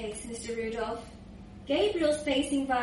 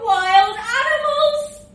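high, loud, drawn-out cheer; proud bragging; 8 semitones higher, 9 dB louder, slower than usual. Acted. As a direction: roar the nickname like a battle cry. Proud nickname of the lads.

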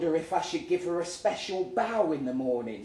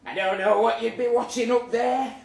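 higher, louder nagging mum voice; mother's voice; 7 semitones higher, 7 dB louder than usual. Retold or imitated. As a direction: put on the mother's fussy tone. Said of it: "she always says".

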